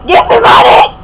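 loud, high mock yell; mock demand. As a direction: yell for cash as a pushy pretend beggar. Demands money as play beggar.

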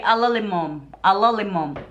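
ALULIMUM as "a-lo-li-mom"; coworker's mispronunciation. Retold or imitated. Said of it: "He always say".

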